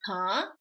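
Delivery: "Huh" rising from low; questioning tone. Shown as a demonstration. Teacher recalling second tone, "Huh" rising. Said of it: "the rising questioning tone".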